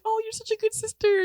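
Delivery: high, sugary mom praise; praising mom. Retold or imitated. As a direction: mom gushing over her daughter. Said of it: "my mom was like".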